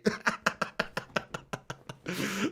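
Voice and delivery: long, breath-only wheezing laugh; tickled laugh. Real, not acted. Streamer tickled by commenter's avatar, long soft real laugh. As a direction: laugh softly till the air runs out. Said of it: "why that's tickled me".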